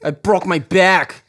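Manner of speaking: slow, high lisping Tyson voice; Mike Tyson impression. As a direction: drag it out in a Tyson lisp.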